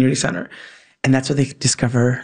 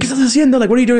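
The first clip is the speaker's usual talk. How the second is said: higher-pitched mock scolding; retold scolding; 10 semitones higher, 4 dB louder, much faster than usual. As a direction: act out an adult catching a kid red-handed. Retold party guests catching the child.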